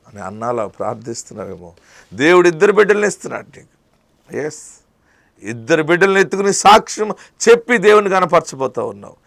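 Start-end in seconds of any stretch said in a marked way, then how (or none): 2.1-9.2 loud, high, impassioned preaching; fiery preaching. Acted. Preacher promising weeping believers healing, loud and fervent. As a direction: preach it out to the crowd with full force.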